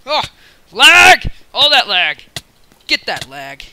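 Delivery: loud angry yell at the lag; frustrated shout. Real, not acted.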